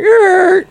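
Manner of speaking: high drawn-out "errr" sound effect; mock cut-off screech. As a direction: screech it like everything grinding to a halt. Imitates a buzzer-like noise, life over.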